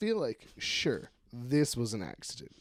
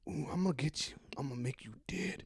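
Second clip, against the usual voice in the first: mock threat; 10 dB quieter than usual. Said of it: "What if his cousin was like".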